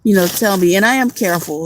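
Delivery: usual speaking voice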